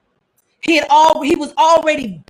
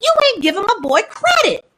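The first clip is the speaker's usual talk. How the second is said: incredulous protest; 10 semitones higher than usual. Real, not acted. Defending Peter, higher and excited.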